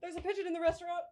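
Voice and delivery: high, hushed panicked repeat; small high comic panic; mock fight-or-flight alarm. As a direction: report it high and softly, frozen in alarm. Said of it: "my fight or flight response went".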